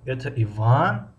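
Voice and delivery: Иван stretched out with the pitch climbing at its end; slow, rising finish on Иван. Shown as a demonstration. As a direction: draw out Иван and lift it like a question. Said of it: "бахши охирро".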